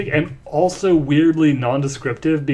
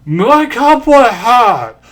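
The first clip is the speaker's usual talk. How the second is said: slow loud yell, higher; 7 semitones higher, 7 dB louder, much slower than usual. Acted. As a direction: call out after the train. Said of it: "and I yelled out".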